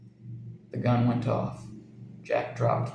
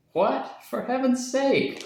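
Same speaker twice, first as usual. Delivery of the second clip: much higher startled protest; shocked cry; 12 semitones higher, slower than usual. A narrator's reading. Jack hears Edna wants the parrot gone, startled. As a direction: recoil in disbelief. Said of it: "cried Jack".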